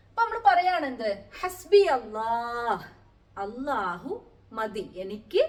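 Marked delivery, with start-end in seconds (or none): 1.4-2.9 slow recitation. Shown as a demonstration. Teacher recites Quranic phrase.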